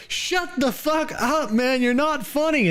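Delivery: very high, slow whiny mock. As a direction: sneer it in a squeaky whine. Mocking a chat bully.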